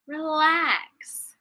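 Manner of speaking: relax lifted high and drawn out, playful; sing-song relax. Shown as a demonstration. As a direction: float the word Relax up high and let it fall slowly.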